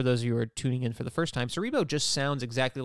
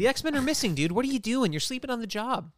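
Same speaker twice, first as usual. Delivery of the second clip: mock scolding; 9 semitones higher than usual. Mock scolding Xavier as Cerebro.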